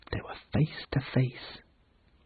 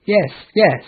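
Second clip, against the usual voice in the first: loud, higher eager reply; sly keen agreement; eager pirates; 8 semitones higher, 8 dB louder than usual. Acted. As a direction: blurt it out greedily. Pirates eagerly answering Peter.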